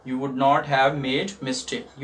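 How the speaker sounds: very slow, clear teaching read; teacher repeating the sentence for students, very slowly; slow model sentence. Shown as a demonstration. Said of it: "teacher repeats slowly".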